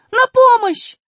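high desperate shout for help; crying for help. Acted. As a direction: scream for help. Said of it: "крикнула Алиса". (отчаянный крик о помощи)

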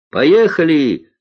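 high, loud call to set off; shout. A narrator's reading. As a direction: call it out to the next plane. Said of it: "крикнула Алиса". (звонкий крик лётчику)

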